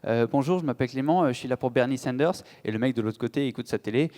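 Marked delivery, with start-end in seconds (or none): none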